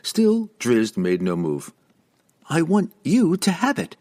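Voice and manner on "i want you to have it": high, eager insistence; insistent. A narrator's reading. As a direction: press the gift on him. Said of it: "Regis insisted".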